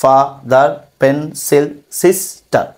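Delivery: Father, pencil, sister each broken in two; a small gap inside each of father, pencil, sister; split into syllables. Shown as a demonstration. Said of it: "আলাদাভাবে ভাগ করে উচ্চারণ করা লাগছে". (Father, pencil, sister দুই ভাগে ভেঙে উচ্চারণ)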